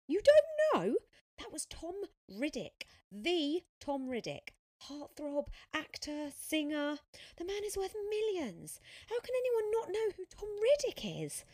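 high, loud, gushing disbelief; incredulous fan girl. A narrator's reading. Fan telling Sophia who the star is, incredulous. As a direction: squeal it, astonished at such ignorance.

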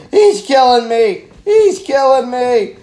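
loud, high, slow mock scream of a dying man; panicked victim yelling. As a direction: ham up a victim being hacked at. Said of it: "all the guy's doing is".

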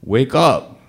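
urgent high shout to a dozing driver; retold panicked yell, loud and high; yelling to wake him. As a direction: shout his name, jolt him awake. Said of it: "And I yelled".

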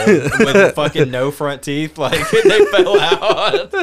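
slow, high words breaking into laughter; cracking up mid-description; laughing. Real, not acted. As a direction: laugh through it, pitch climbing.

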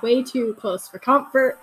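ordinary delivery

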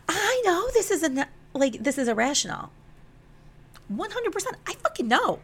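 very high, strained insistence; exasperated admission. Retold or imitated. Admitting to him she is irrational, exasperated admission. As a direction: pitch it way up, protesting in exasperation.

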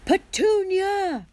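very high, drawn-out furious yell; Uncle Vernon's roar. A narrator's reading. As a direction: roar the name for help, stretched long. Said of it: "roared Uncle Vernon".

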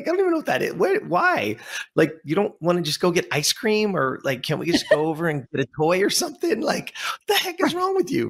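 high-pitched, hammed-up bafflement; mock a mother at a loss, voice jumping up; exasperated mom. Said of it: "Like any mom to a thirteen-year-old kid".

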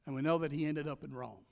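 ordinary talk, nothing marked

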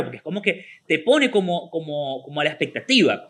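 usual speaking voice

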